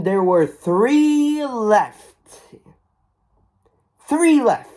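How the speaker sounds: rising to a high, loud squeal of excitement; can't believe it, there were only three; high excited squeal on three left. Real, not acted. Excited about last replicas.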